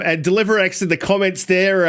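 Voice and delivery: ordinary delivery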